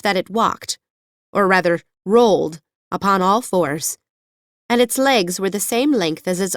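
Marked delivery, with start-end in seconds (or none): none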